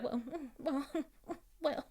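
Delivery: very soft, halting mock stammer; imitated hesitant mumble. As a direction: act lost for an answer, barely audible. Mimics Sheree stumbling for an answer.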